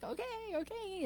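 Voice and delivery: put-on high, hushed and quick, like a timid young cub; lion cub meekly going along with the sheep, high and soft; meek little cub. Sheep-raised cub agrees.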